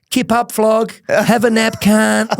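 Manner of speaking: high, laughing mock taunt over a knocked-out mate; teasing mate voice, high and amused. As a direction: needle him like a mate cackling at a passed-out friend. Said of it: "like this".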